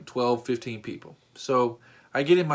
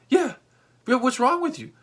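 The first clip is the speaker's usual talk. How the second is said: exasperated; 10 semitones higher than usual. Retold or imitated. Arguing back at Steve.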